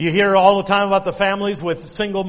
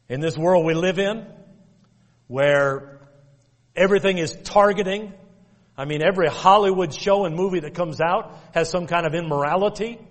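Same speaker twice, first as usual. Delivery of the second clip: ordinary delivery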